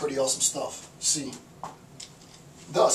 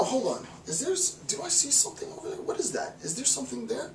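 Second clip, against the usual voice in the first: curious peering; 8 semitones higher, 5 dB quieter than usual. Retold or imitated. Institution spots a flashing dot.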